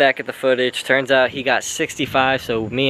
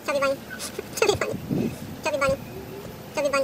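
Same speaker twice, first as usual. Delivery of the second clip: high mimicking; 13 semitones higher, 9 dB quieter, much faster than usual. Mocking his friend's stuffed-mouth attempts.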